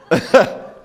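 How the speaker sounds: short breathy laugh; a burst of breathy laughter at his own joke. Real, not acted. Speaker laughing at his own joke, airy laugh.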